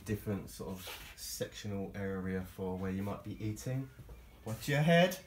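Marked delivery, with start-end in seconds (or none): 4.5-5.2 louder, higher heads-up call; warning call. Real, not acted. Tour guide to camera person in a tight boat, warning. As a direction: call out a quick warning, raised. Warning someone in low space.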